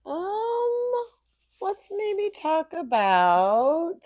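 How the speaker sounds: high, slow, playful drawl; put-on voice. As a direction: stretch it out in a silly voice.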